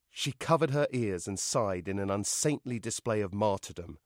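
usual speaking voice

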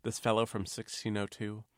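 plain reading voice

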